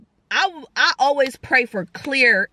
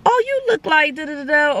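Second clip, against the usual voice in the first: imitating strangers; 4 semitones higher than usual. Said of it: "they'll, like, see somebody and be like".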